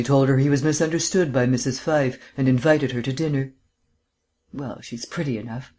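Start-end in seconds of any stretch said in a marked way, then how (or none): 4.5-5.7 offhand and quieter, not much interested; indifferent. A narrator's reading. Markham shrugs off the girl's looks, indifferent. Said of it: "commented Markham indifferently".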